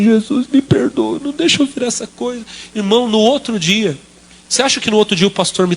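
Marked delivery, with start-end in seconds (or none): 0.0-2.4 tearful prayer. Retold or imitated. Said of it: "eu chorava assim".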